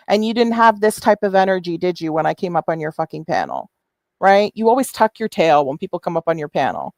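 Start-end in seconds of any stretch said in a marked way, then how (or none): none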